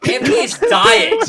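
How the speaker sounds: high shout through laughter; cracking up mid-sentence; blurting it out while laughing; laughing hard. Real, not acted. Laughing retelling of friend's fall.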